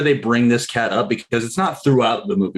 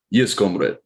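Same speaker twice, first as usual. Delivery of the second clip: lower-pitched Russian comrade voice; Russian accent demo; 4 semitones lower than usual. Shown as a demonstration. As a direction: show how a Russian accent should go. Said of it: "we gotta get into it like".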